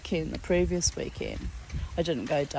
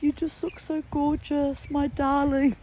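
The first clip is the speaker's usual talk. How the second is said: high, lilting retold compliment; lightly voiced kindly man; sweet compliment; 9 semitones higher than usual. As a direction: imitate his fond admiration. Retelling the man's compliment.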